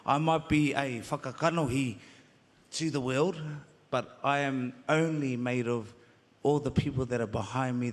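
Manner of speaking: ordinary talk, nothing marked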